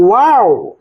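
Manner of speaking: ওয়াও said as "wow", rising then falling; teacher's "wow" glide on ওয়াও; pronunciation demo. Shown as a demonstration. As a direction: model ওয়াও as one gliding "wow". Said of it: "আর উচ্চারণটা কেমন হবে?".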